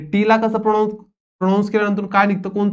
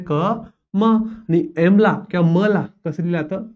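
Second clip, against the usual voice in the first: ordinary talk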